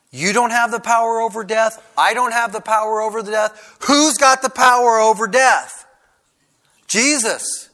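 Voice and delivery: high, loud preacher's challenge; impassioned preaching. Real, not acted. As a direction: drive the question home to the congregation.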